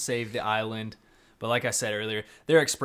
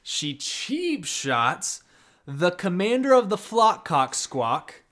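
hyped commentary; 6 semitones higher, 5 dB louder, slower than usual. Acted. Mock sports call.

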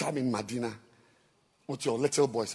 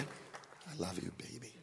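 slow, much softer and lower; soft love line; 5 semitones lower, 16 dB quieter, slower than usual. Shown as a demonstration. Preacher showing the small-voice way to tell baby I love you. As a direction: breathe it slowly and gently. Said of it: "Love is expressed in a small voice".

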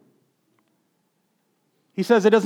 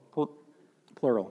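usual speaking voice

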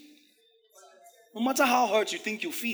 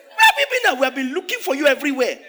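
much higher, louder panicked calling, laughing; frantic mother; 7 semitones higher, 8 dB louder than usual. Retold or imitated. Mary as a panicked mother searching for her son. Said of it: "And I said".

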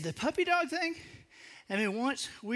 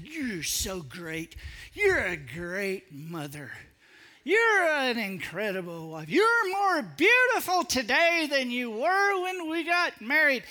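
very slow, swelling and louder gushing praise; puppy-dog flattery; 3 semitones higher, 4 dB louder, slower than usual. Acted. Husband showering his wife with praise, slow gushing build. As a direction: gush over her slowly, growing louder toward the end. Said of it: "I'm speaking to her as a puppy dog".

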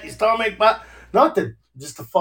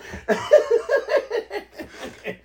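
high, breathless laughter; giggling fit; 4 dB quieter than usual. Real, not acted. Cracking up at the English Jersey accent idea, giggling.

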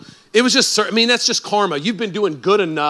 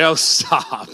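bashful laugh; 2 semitones lower than usual. Retold or imitated. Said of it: "I'm like".

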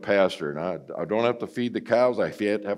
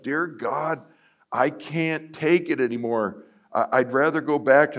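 higher pleading cry; retold anguished plea; desperate prayer; 6 semitones higher than usual. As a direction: beg God at the end of his rope.

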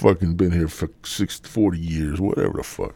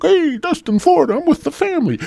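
very high, gushing imitation; mock vacation poster. Family man posting on Facebook, mock gushing. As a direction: squeal it like a bragging holiday post.

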